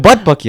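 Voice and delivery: "bad bucky" said high and loud; odd pronunciation. Real, not acted. Friend telling the others what his family calls it, odd pronunciation. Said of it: "why are you saying it like that".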